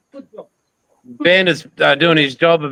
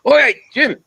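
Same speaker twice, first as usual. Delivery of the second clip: calling out; 10 semitones higher than usual. Retold or imitated. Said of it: "I'll be going".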